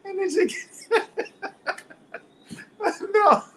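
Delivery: laughing through the words; can't hold back the laugh while reading. Real, not acted. Teacher reading a silly answer, laughing.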